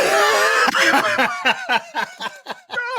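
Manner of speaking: high loud cackling laugh; real laugh, high and loud. Real, not acted. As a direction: burst out laughing. Laughing at own joke.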